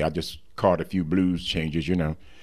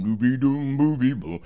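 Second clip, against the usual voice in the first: imitated bass line; 6 semitones higher than usual. Shown as a demonstration. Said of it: "make the bass go boom".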